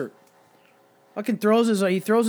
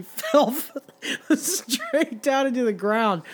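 laughing; 6 semitones higher, slower than usual. Real, not acted. Laughs while telling of the friend's fall.

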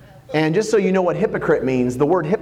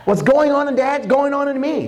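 higher-pitched, fervent sermon delivery; heartfelt pulpit proclamation, pitched up; impassioned preaching; 10 semitones higher than usual. Acted. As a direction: preach it with rising urgency, speaking as Jesus. Preaches as Jesus explaining Himself.

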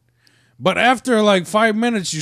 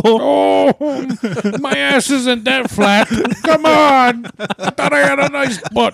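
higher, slow hurt whine; mock hurt whine; 8 semitones higher, slower than usual. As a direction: whine like your feelings are hurt. Mock hurt fat guy.

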